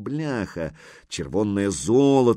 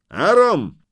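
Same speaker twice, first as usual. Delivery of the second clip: high, loud call of a name; shouting; 9 semitones higher, 9 dB louder than usual. A narrator's reading. Horseman summoning his companion, shouting. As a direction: call out sharply to your companion. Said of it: "крикнул он одному".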